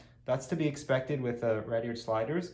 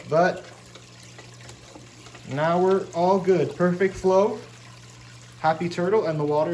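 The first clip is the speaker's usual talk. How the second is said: raised voice over bubbling water; yelling over noise; 3 semitones higher, 4 dB louder than usual. Real, not acted. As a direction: call out over the filter noise. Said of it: "Sorry, I'm yelling over here".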